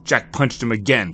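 plain reading voice

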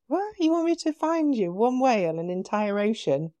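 high-pitched incredulous question, easing down; retold disbelief. Guest retelling her reply to the whale, incredulous. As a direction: act out baffled disbelief at a huge request.

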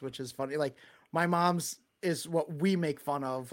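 ordinary talk

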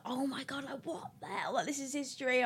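hushed mock-tearful amazement; imitated tearful awe. Friend calls her crying over the casting news, imitated tearful awe. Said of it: "she called me crying".

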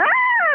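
playful high-pitched ouch; retold scream. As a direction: yelp Ah like a bee just stung you. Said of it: "I started screaming".

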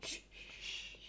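soft unvoiced shushing. Real, not acted. Hushing the listeners before a secret, unvoiced. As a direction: hush everyone before a secret. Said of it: "Nu ska vi vara tysta här".

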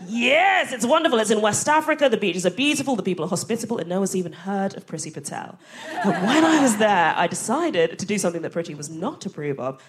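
usual speaking voice

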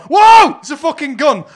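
loud, high shout of alarm; mock alarm. Wrestler selling a finger gun to the crowd, mock alarm. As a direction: overplay the panic at a gun. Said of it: "sells it as if to go".

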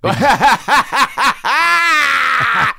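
loud burst of laughter. Real, not acted. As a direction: laugh out hard. Big laugh at the jab.

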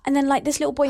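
usual speaking voice

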